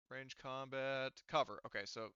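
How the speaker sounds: ordinary talk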